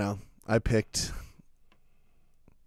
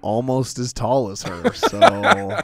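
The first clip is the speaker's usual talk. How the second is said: laughing imitation; 3 semitones higher, 7 dB louder, slower than usual. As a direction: voice breaking into laughter. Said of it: "He's like".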